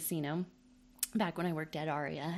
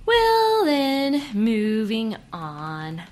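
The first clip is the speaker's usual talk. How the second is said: awkward; 3 semitones higher, 10 dB louder, much slower than usual. Real, not acted. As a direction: cover the awkward silence. Awkward recovery after joke.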